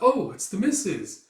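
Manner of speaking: high, loud swooping greeting; pleasantly surprised. Retold or imitated. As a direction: greet the lady of the house with surprise. Servant greets the missus.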